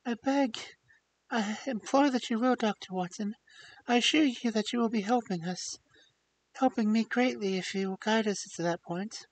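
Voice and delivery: high, sincere imploring plea; sincere pleading. Acted. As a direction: implore him earnestly. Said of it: "a ring of sincerity in the woman's voice".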